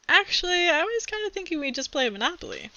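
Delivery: a hint of a bashful boy; Rob's reply. Rob answers Wonkenstein.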